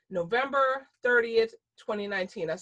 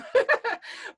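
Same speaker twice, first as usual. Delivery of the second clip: short burst of laughter; bright laugh; 5 dB louder than usual. Real, not acted. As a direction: laugh at your own joke. Laughs at own joke.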